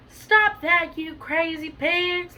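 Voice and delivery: loud, high, drawn-out scolding; storybook yell. A narrator's reading. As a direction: call it out big for a children's read-aloud. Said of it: "Mister and Missus Fenster yelled".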